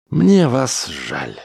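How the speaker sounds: slow, high, drawn-out pity; emphatic pity. A narrator's reading. Smith warns Chauns about telling Earth, pitying emphatically.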